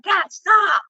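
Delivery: high-pitched plea, re-enacted; retold exasperated shout. Daughter to dad cussing out bus drivers, exasperated shout. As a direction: call out to rein in an embarrassing dad.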